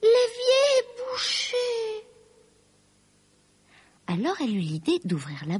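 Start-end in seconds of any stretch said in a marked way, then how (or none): 0.0-1.6 very high, wailing exclamation; distressed high-pitched call; dismayed cry. A narrator's reading. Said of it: "s'écria-t-elle".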